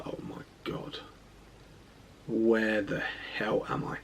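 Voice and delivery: very soft, slow, low murmur; retold hushed disbelief to oneself; hushed dismay. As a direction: say it slowly under your breath. Alone on a dark ward at night.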